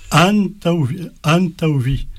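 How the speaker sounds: "antauvi" with AN held long and lifted; stress on AN. Shown as a demonstration. Teacher demonstrating the trick on another word, stress on AN. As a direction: lean hard on AN in "antauvi". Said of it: "ça t'oblige à prononcer le an".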